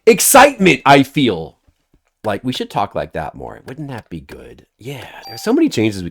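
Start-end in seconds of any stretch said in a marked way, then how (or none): 0.1-1.6 announced feeling. Shown as a demonstration. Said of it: "you should say".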